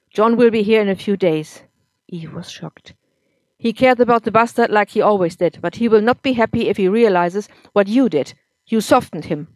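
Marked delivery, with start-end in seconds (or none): 0.1-1.6 put-on loud, bitter drunk husband; drunk angry husband. A narrator's reading. 3.6-9.5 put-on loud, bitter drunk husband; drunk angry husband. A narrator's reading.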